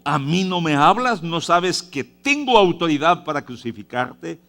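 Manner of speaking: stern demand. Acted. Pilate threatens Jesus.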